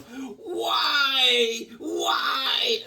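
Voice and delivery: high wailing shout of despair; anguished cry. Retold or imitated. Hawkman crying out in hammed-up despair, high wail. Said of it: "here's Hawkman going".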